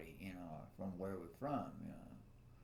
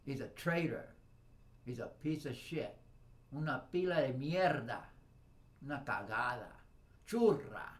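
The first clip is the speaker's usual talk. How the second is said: angry cursing; 4 semitones higher, 6 dB louder than usual. Real, not acted. Cursing the devil.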